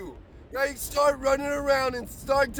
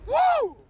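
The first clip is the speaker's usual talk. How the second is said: high, loud joyful whoop; ecstatic whoop; 13 semitones higher, 5 dB louder than usual. Real, not acted. Morning preacher whooping for his viewers, ecstatic whoop. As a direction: let out a wild happy whoop. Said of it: "when I get super excited".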